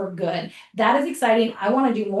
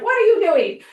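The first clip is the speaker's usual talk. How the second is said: higher, louder mock scolding; exasperated scold; 9 semitones higher, 5 dB louder than usual. Coach scolds younger self, loud exasperated mock scold. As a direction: call out your younger self in disbelief. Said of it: "you're like".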